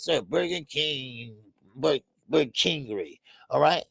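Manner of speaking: normal talking voice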